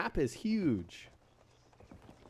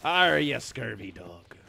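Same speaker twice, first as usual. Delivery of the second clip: put-on pirate accent; 4 dB louder, slower than usual. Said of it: "this accent".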